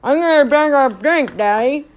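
put-on mushy, muffled child's plea; garbled mud-caked call. A narrator's reading. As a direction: call out without moving the lips. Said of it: "Patsy would call up or wake up and call".

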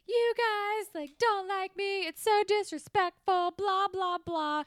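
high, whiny-loud imitated tantrum; imitating a teen's outburst; mock yelling. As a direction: rant at the parents, hammed-up. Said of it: "he's yelling at her parents".

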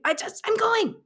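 high, urgent outburst; flustered insistence. Retold or imitated. Casting director cuts off baffled Devin to leave, flustered high outburst.

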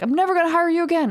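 high, loud, strained mock rant from an angry boss; shrill mock outrage from the cameraman on the phone. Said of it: "he was really mad".

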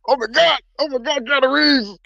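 overjoyed, breathless starstruck gush. Retold or imitated. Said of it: "I'll be like".

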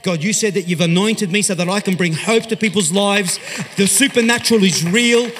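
rising, loud proclaiming prayer; bold declaration. Retold or imitated. Preacher shouting his prayer to God, loud and rising.

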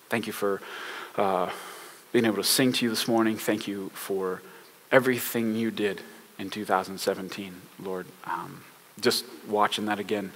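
soft, low, unhurried prayer voice; hushed sincere church prayer. Real, not acted. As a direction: pray it quietly and sincerely. Leads the opening prayer.

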